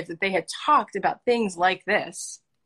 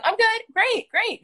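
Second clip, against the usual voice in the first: very high, fake-fine pleasantries; mock cheery small talk. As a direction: imitate polite chirping to an acquaintance. Acted polite grocery store small talk.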